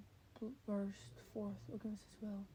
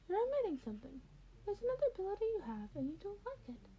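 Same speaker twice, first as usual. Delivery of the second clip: higher, quick and sly insistence; knowing Nezu; 11 semitones higher, faster than usual. Acted. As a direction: lean in, you already know his secret. Nezu probes Denny's secret.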